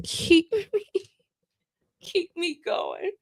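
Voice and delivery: high, laughing catch on keep me going; laughing while thanking a friend; laughing through it. Real, not acted. Thanking her director of operations, laughing up high.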